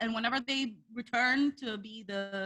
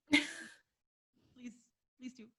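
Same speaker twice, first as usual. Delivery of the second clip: soft unvoiced begging; whisper; 10 dB quieter, much slower than usual. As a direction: whisper a little plea. Echoing the whispered plug.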